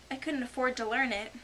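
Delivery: plain reading voice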